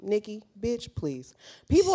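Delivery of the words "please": ordinary delivery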